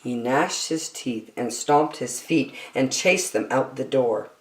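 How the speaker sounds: plain reading voice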